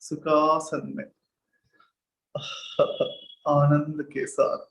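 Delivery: slow, measured meditation guide; guided meditation. Real, not acted. Teacher guiding students to sit at ease with the breath, slow meditation voice. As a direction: lead them into stillness, drawing out words between long silences.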